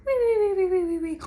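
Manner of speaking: squeaky pretend snoring; comic snore whistle. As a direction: imitate a cartoon snore's whistling out-breath. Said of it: "But the Bear snores on".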